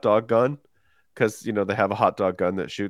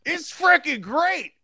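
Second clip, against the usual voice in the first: high, loud excited Midwestern shout; Midwestern fan yell; 4 dB louder, slower than usual. Retold or imitated. A Midwestern fan yells to Jan at the game, high and loud. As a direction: holler to Jan across the stands. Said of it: "you can hear somebody going".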